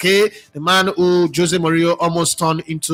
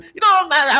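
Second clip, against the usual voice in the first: ordinary delivery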